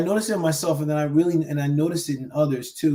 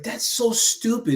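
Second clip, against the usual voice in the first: sneering, higher put-down; condescending; 8 semitones higher than usual. Retold or imitated. Coach demonstrating a condescending inner voice. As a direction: talk down to yourself. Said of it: "this really destructive / condescending".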